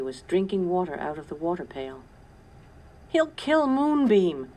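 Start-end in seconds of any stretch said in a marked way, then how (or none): 3.1-4.5 high, loud and drawn-out cry; frightened shout. A narrator's reading. Caleb fearing for Moonbeam, slow loud shout. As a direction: shout it in panic for the horse. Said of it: "shouted Caleb".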